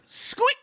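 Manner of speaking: high squeak. Retold or imitated. Imitating bike squeak.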